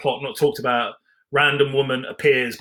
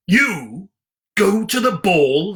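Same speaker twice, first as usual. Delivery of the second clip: mocking; 7 semitones higher, 5 dB louder, much slower than usual. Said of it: "laughed the sisters".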